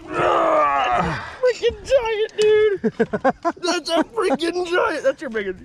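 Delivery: high, loud thrilled shouting; relieved laughter. Real, not acted. Giant catfish in net.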